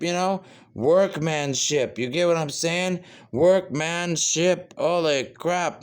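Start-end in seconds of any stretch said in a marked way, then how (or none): 0.7-2.0 WORKMANSHIP stretched out slowly, each part weighted; "Workmanship" said slowly and deliberately for emphasis; emphatic repetition. Real, not acted. Repeats word to drive it home. 3.3-4.7 WORKMANSHIP drawn right out, higher pitched; "Workmanship" slow and raised in pitch, hammering it home; emphatic repetition. Real, not acted. Repeats word again, slower.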